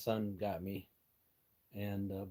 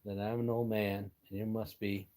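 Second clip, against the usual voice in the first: usual speaking voice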